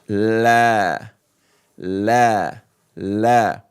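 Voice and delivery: la, la, la said low; Arabic la. Shown as a demonstration. Teacher drilling la. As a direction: say la like English L. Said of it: "like the letter L".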